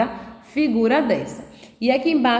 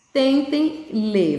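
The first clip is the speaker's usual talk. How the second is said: slow coaxing; 3 semitones higher, much slower than usual. Shown as a demonstration. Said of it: "teacher urging to read".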